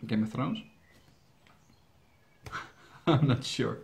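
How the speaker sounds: ordinary delivery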